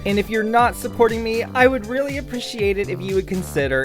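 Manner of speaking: high, bright, bouncy sponsor pitch; upbeat ad read. Acted. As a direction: sell it like a peppy commercial. Upbeat Patreon plug.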